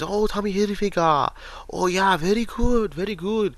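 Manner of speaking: put-on excited Japanese accent over Tommy Hilfiger; imitating Japanese accent. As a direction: mimic a Japanese man's accent, pitched high. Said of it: "this accent".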